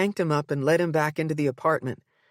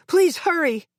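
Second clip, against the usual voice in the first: high, louder plea; urgent frightened plea; begging; 13 semitones higher, 4 dB louder than usual. A narrator's reading. Mother begging the operator, urgent.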